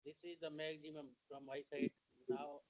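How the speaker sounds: very faint, distant voice; barely audible. Real, not acted. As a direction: speak as if the mic barely picks you up. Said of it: "very faint".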